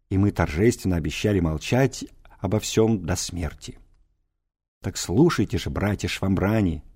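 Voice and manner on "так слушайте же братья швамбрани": usual speaking voice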